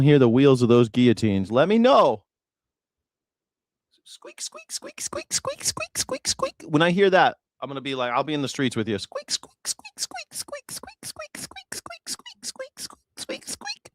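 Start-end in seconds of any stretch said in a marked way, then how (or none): 4.0-6.5 tiny high squeaks, almost whispered; squeaky wheel noise. Retold or imitated. The host mimics guillotine wheels rolling into the streets, high whispery squeaks. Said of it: "That's the noise of the guillotines". 9.0-13.9 tiny high squeaks, almost whispered; squeaky wheel noise. Retold or imitated. The host mimics guillotine wheels rolling into the streets, high whispery squeaks. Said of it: "That's the noise of the guillotines".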